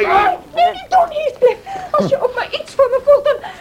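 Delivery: very high, loud desperate plea; frightened cry to stop a fight; frantic pleading. Acted. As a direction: beg him to stop, panicking. Cathy begs Heathcliff not to fight.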